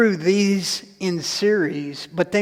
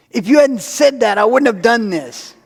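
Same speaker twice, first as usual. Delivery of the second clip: louder, higher, accusing the wife; mock blaming shout; 5 semitones higher, 6 dB louder than usual. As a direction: snap it back at her like a sulky husband. Blaming wife after cutting finger.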